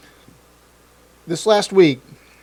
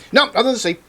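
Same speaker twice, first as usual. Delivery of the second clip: much higher mock brushoff; mock dismissal; 11 semitones higher, 4 dB louder, faster than usual. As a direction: dismiss it in a high mocking voice. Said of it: "suddenly it's like".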